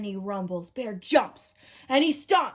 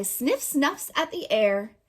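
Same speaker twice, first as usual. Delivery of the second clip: usual speaking voice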